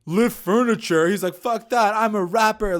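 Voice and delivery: imitating a friend refusing work; mock outraged. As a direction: scoff it like an insulted would-be rapper. Acting out Pauly's outrage.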